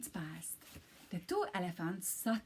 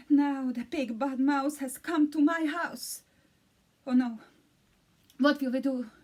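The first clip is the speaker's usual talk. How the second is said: louder, higher panicky cries; panicked elephant; 4 semitones higher, 5 dB louder than usual. A narrator's reading. Second elephant panics at the door, lightly voiced alarm. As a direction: build to a run-for-your-lives panic.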